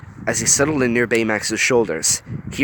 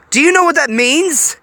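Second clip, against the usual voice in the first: loud confrontation; 8 dB louder than usual. A narrator's reading. Gogo confronting Honey.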